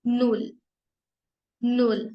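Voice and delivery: नुल said as German "null" with a short u; clear, careful teacher demo of नुल; नुल repeated slowly for students. Shown as a demonstration. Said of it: "zero को हम बोलते हैं नुल".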